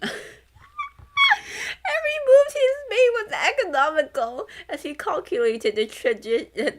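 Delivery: reading while breaking into loud high laughter; words squeezed out between fits of laughter; laughing through the words. Real, not acted. Streamer reading a novel aloud, cracking up mid-sentence.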